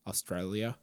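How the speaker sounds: a quick, failed stab at an Australian accent on "Australia."; bad Australian accent. Shown as a demonstration. Host introducing a guest from Australia, attempting a weak Australian accent. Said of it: "That, that's a bad accent".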